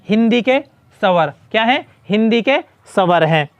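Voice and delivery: ordinary talk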